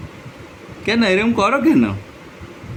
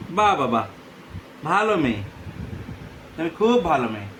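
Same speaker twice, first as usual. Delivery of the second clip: soft praise; 3 semitones lower, 7 dB quieter than usual. Real, not acted. Praising baby after eating.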